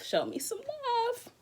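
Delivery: "Show me some love" sung out high and slow, drawing out "love"; high slow plea. Shown as a demonstration. Streamer begging viewers for donations in a high, slow plea.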